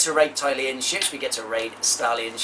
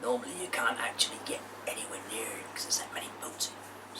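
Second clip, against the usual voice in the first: whisper; 3 semitones lower, 12 dB quieter than usual. Acted. Secret whispering.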